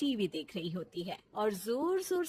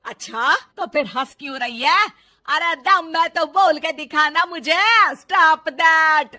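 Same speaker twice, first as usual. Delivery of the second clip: angry challenge; 4 semitones higher, 14 dB louder than usual. Acted. Mother-in-law challenges daughter-in-law, loud angry dare. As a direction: dare her with a loud sneer.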